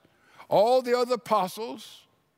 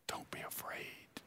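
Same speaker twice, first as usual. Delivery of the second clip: whispered reassurance; 22 dB quieter than usual. Acted. Jesus whispering comfort to the terrified John, whispered. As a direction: whisper it to calm a frightened friend.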